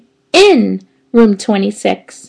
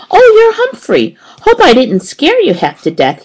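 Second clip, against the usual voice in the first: ordinary delivery, nothing marked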